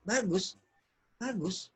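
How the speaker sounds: soft, unconvinced "bagus"; frowning, disapproving praise. Shown as a demonstration. Coach shows good meaning not good, frowning disapproval. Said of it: "itu artinya tidak bagus".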